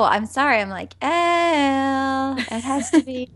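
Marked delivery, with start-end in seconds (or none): 1.0-2.4 "L" drawn out as "eh-ull"; higher, long sliding "L"; two-syllable L. Shown as a demonstration. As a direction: stretch "L" slowly into two syllables. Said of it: "I can't say L with one syllable".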